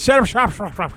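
fumbling set up shop fast; tongue twister attempt. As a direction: trip over the words laughing. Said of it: "Say that five times fast".

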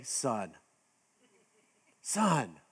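low, drawn-out warning "Son"; a hint of a father's stern warning; stern dad's "Son". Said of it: "I can't really do it the way he does it".

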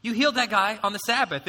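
a touch of scandalized disbelief; mock freak-out. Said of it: "the Pharisees would freak out".

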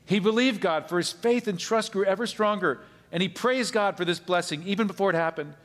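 forceful pulpit reading; emphatic preaching. Acted. Declaims the verse forcefully.

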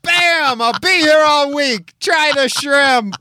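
loud high hammy comedian; corny stand-up comic. Pretend. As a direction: sell the joke like a lounge comic. Stand-up comic punchline bit.

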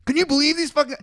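high, loud, indignant griping impression; mock whiny coworker. Mocking the coworker's daily complaint, high and loud. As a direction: whine it like the sulky coworker.

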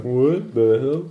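slow, puzzled neighbour voice; startled neighbour. Acted. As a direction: drag out a baffled what the hell. Neighbours peeking out, GM voices them.

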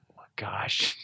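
whispered retold exasperation; exasperated. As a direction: imitate disbelief under your breath, softly. Exasperated aside in retold exchange.

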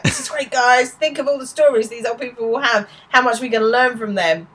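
imitated gushing enthusiasm, pitched high; enthusiastic. Will rallies his friends at the old people's home, enthusiastically. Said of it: "Will's all enthusiastic".

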